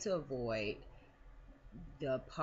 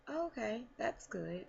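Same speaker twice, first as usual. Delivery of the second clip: unimpressed; 8 semitones higher, 4 dB quieter than usual. Retold or imitated. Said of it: "she was like".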